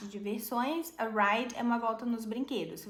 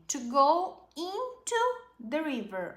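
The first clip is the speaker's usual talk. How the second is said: slow model reading; 6 semitones higher, 4 dB louder, slower than usual. Shown as a demonstration. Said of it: "slow model reading".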